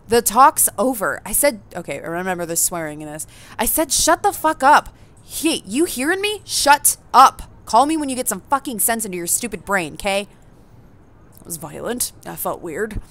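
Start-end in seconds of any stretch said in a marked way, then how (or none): 0.1-1.2 slow, louder, cutting the call short; angry phone voice. Acted. Skater snaps at someone on the phone, angrily. 3.6-10.3 much higher and louder, spitting the swearing; furious phone yell. Acted. Skater screams down the phone at a relative, furious.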